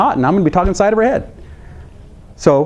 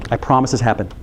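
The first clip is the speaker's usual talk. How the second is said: ordinary talk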